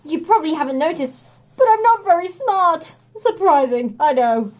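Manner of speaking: big strongman admitting a weakness; booming sheepish Hercules; sheepish hero. A narrator's reading. Hercules voiced in story.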